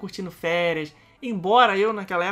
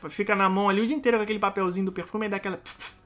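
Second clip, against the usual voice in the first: ordinary talk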